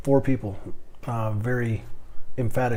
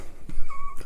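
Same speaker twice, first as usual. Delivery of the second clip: short laugh; 3 dB louder than usual. Real, not acted. Laughs at his joke.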